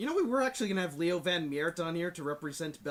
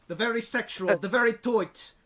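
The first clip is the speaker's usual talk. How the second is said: hammed-up Dutch accent, higher and louder; mock Dutch accent, punched out; Goldmember; 5 semitones higher, 5 dB louder than usual. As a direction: do a silly movie-villain Dutch accent. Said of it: "whenever I do a Dutch accent, I sound like Goldmember".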